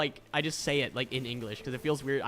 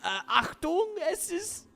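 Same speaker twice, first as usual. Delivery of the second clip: much higher voiced German warning; 3 dB louder, much slower than usual. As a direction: squeak it out like cartoon Po warning. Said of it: "he's like".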